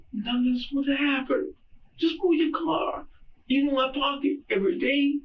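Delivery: high, tearful pleading, voice breaking; crying. Real, not acted. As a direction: cry out the plea, near sobbing. Breaks down recounting the dispute.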